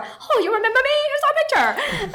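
put-on high, eager Japanese-accented English; quick replay of the fan in a high Japanese accent; Japanese accent impression. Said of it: "my embarrassing Japanese accent".

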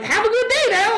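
very high, sing-song farewell. Retold or imitated.

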